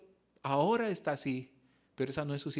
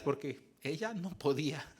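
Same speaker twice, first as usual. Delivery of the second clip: ordinary talk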